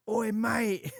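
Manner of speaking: high-pitched mock challenge called out; imitated angry call. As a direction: imitate an indignant man calling someone out. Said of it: "he heard".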